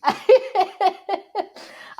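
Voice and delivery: high, loud embarrassed laugh; burst of laughter. Real, not acted. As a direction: laugh at being caught out. Caught out, laughing.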